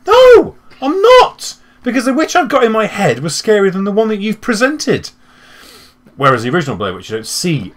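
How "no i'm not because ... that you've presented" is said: squeaky indignant mock voice; high mock scoff. Mocking a horror film audience.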